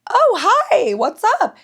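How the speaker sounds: high-pitched, chirpy acted greeting; acted friendly surprise. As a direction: replay a startled, over-friendly hello. Acts out her greeting to stranger.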